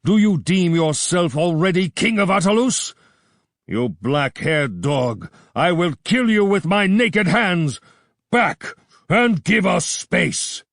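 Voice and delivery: a king in a blaze of passion; enraged king. A narrator's reading. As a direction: bellow like a bull. Enraged king threatens Gordon.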